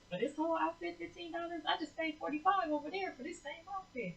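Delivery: high, amazed disbelief at the price; astonished customer. Retold or imitated. Retold shopper stunned by the cheap outfit, high and amazed. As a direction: squeal it out like you can't believe the bargain. Prophetess reacting to low prices.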